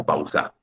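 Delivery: pau-SA, stress wrongly moved to the end; stress on last syllable. Shown as a demonstration. Teacher shows what nobody says, "pausa" stressed on final SA. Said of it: "Nadie pronuncia pausa".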